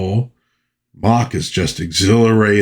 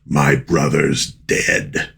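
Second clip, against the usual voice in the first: low, slow, heavy character voice; slow, deep imitation of a grieving henchman; Toad; 5 semitones lower, much slower than usual. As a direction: drop low and drag it out, a grim avenger. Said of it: "Toad just says".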